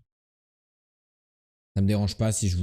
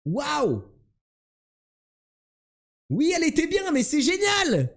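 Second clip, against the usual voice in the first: fake excited voice; slower than usual. Keeps the gushing put-on voice.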